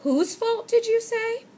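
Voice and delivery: high, mock-astonished question; exclaiming. A narrator's reading. Storekeeper, high and mock-astonished, turns the blame back. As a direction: feign shock, way up high. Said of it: "the storekeeper exclaimed".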